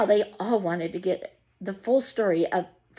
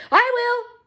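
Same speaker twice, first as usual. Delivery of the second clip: high, loud eager shout; 4 dB louder than usual. A narrator's reading. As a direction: leap in and claim it. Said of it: "shouted Grandpa Joe".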